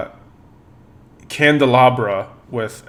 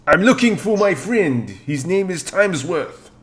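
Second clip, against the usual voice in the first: put-on higher pleading French accent; french chef; 6 semitones higher than usual. Candelabra asks for help.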